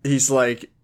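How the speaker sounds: usual speaking voice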